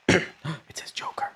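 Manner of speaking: soft whisper. Real, not acted. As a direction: whisper it to yourself. Reading game text aside.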